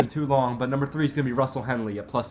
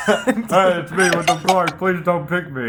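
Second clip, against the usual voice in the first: Wyndham Clark voice; 5 semitones higher, 7 dB louder, slower than usual. Pretend.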